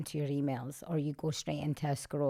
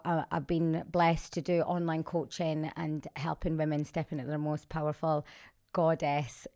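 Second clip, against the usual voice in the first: ordinary talk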